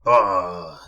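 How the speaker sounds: low, measured royal voice; goblin king. A narrator's reading. King receiving Mister Uppity, low regal voice. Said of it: "said the king".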